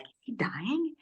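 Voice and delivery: soft unvoiced whisper, lifting at the end; hushed worried question; frightened whisper. The mother asking at the bed, a soft frightened whisper.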